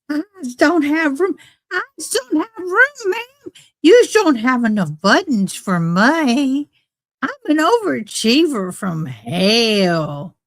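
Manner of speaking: high, singsong mock complaint; hammed-up self-pitying whine. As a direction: whine it playfully, pitch swooping up and down. Comic whining at a form.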